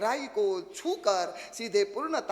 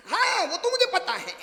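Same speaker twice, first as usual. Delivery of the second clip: high laughing mock know-it-all; know-it-all bride; 9 semitones higher, 5 dB louder than usual. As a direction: toss it off high and smug, laughing. (हँसती हुई ऊँची आवाज़ में शेखी)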